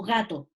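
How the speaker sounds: "gato" with a soft voiced g; "gato" opening on a soft g, no throaty friction. Shown as a demonstration. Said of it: "suena suave cuando yo digo".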